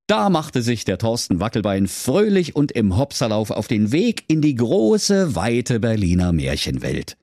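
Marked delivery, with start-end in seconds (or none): none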